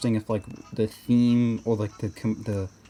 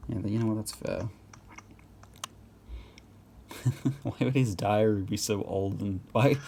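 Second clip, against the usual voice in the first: ordinary talk, nothing marked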